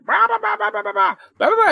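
high, loud babbling din; hammed-up yelling and barking noises; imitated household racket. Mimicking the noise in his friend's house, shrill mock yelling. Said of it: "is kids yelling, dogs barking, women screaming".

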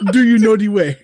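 put-on high, loud African-accent meme voice; high mock Ugandan accent. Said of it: "the pretty good African accents first".

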